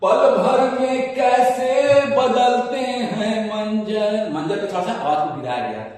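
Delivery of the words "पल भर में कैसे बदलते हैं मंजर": पल sung higher and louder, slowly; stress on पल. Shown as a demonstration. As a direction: press the word पल with force. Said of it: "जोर देकर".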